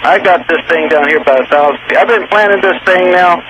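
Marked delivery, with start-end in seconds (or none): none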